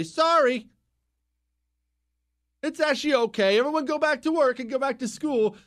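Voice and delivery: sing-song fake reassurance; hammed-up official backtracking; mock backpedal. Mock public announcement after hammering panic.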